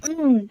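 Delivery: उम as a soft closed-lip "mmm"; quiet isolated M hum for उम; M with pressed lips. Shown as a demonstration. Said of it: "होठों को दबा के".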